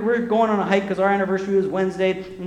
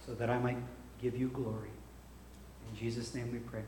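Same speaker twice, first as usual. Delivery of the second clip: hushed prayer; 5 semitones lower, 14 dB quieter than usual. Acted. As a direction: pray quietly over the congregation. Leads closing prayer.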